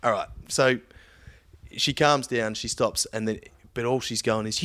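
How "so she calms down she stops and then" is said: ordinary talk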